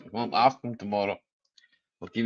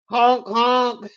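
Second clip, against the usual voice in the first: mock horn honk; 10 semitones higher, 3 dB louder than usual. Imitating a horn.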